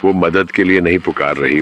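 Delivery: ordinary delivery, nothing marked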